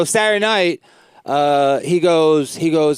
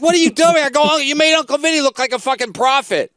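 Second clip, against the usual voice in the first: high-pitched exasperated scolding; retold yelling; 8 semitones higher than usual. Comic yelling at his opener on the way home, exasperated. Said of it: "I was yelling at him".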